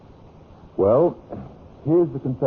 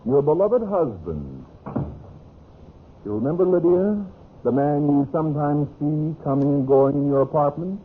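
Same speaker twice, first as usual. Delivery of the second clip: higher-pitched, sardonic sing-out; mocking sarcasm; 7 semitones higher than usual. Husband taunting his unfaithful wife, sarcastic. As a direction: needle her with mock sweetness.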